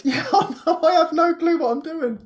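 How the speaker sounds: high, laughing admission; amused helplessness. Retold or imitated. Gardener answering a compliment with no clue, high and laughing. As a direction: giggle through it, pitched well up. Retells own giggly reply to compliments.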